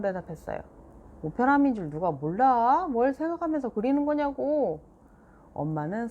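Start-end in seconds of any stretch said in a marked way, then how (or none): 1.2-4.8 higher, louder irritated mom; irritated scolding. A narrator's reading. Mom scolding Seoyeon for a careless answer, irritated. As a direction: snap back at the careless answer.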